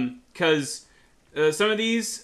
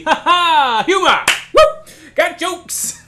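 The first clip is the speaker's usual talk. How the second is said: giggling high and loud at own joke; laughing; 11 semitones higher, 6 dB louder, slower than usual. Real, not acted. Gamer laughing at her own joke. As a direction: break into a laugh on the punchline.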